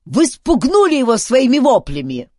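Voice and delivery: high, loud accusation; exasperated yell; angry shout. A narrator's reading. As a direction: blame them crossly at full voice. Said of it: "сердито крикнул Дункан".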